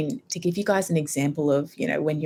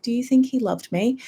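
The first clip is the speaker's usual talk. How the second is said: a hint of a child's voice, pitched higher; little child; 6 semitones higher than usual. Retelling her own blurted question to mum, lightly childlike. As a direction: lightly imitate a small child asking. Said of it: "sounded like a little child".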